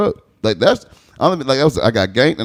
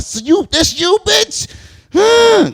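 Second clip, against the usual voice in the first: much higher, rising to a loud Huh; high accusing; 4 dB louder, much slower than usual. Retold or imitated. Confronting his cousin, high and accusing. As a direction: catch him out, climbing up. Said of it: "I'm like".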